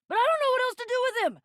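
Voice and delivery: high, very fast, frustrated; exasperated. Acted. At wits' end over what to do with him, exasperated.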